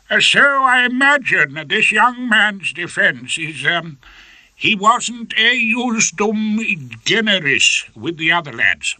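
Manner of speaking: high, slow, lordly judge voice; fussy old judge. Acted.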